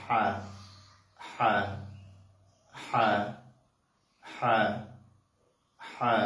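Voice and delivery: হা breathed from the throat, not Bengali হা; Arabic throat ha. Shown as a demonstration. Teacher showing the Arabic ha, not Bengali. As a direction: press lightly in the throat for হা. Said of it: "একটু চাপ দিয়ে এটাকে আদায় করতে হবে".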